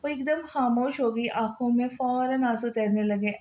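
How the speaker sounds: ordinary delivery, nothing marked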